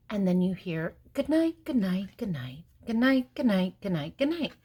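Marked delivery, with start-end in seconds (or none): 1.1-4.5 louder, playful chorus of many goodnights; chorus of animals. Acted. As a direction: call out each goodnight like a different animal.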